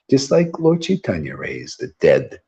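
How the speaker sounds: usual speaking voice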